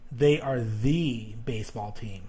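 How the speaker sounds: slow, emphatic "the", pronounced thee; stress on THE. Shown as a demonstration. Teacher showing the one and only team, stressed THE as thee. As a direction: lean on "the" as a long thee before "baseball". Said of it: "they really want to draw emphasis".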